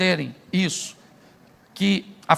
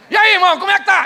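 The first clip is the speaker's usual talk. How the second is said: hearty greeting; 10 semitones higher, 7 dB louder, faster than usual. Retold or imitated. Pastor greets man six months later.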